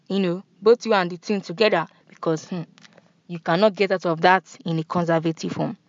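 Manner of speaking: ordinary talk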